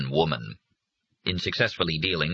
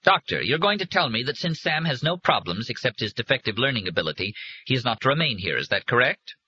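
firm, raised voice cutting in; 6 semitones higher than usual. A narrator's reading. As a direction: insist on the point, higher. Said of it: "broke in firmly".

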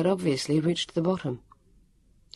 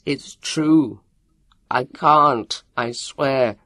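loud, desperate insistence; screaming; 6 dB louder, much slower than usual. A narrator's reading. Leon swearing to his captor, screaming. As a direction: cry out each short phrase urgently. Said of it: "a moment later he screamed".

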